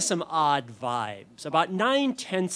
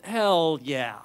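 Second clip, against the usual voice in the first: resolute answer. Retold or imitated. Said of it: "only one answer I can give".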